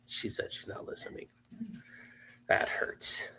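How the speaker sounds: hushed mock hurt aside; whispered. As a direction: whisper it like a wounded joke.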